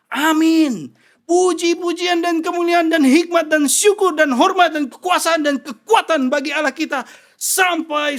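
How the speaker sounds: high, loud shouted praise; fiery sermon shout; preaching at full force. Acted. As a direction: lift the hall with praise.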